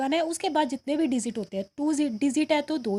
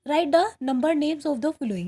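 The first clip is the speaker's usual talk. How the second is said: each word read out very slowly; slow dictation; 3 dB louder, much slower than usual. Shown as a demonstration. Teacher reading the worksheet question to students, very slowly. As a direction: read the question slowly so the class can follow. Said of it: "teacher reads question aloud".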